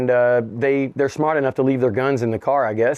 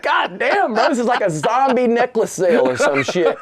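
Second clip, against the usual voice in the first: high, laughing through the words; laughing disbelief; 9 semitones higher than usual. Retold or imitated. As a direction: chuckle at the absurd sale. Incredulous reaction, laughing.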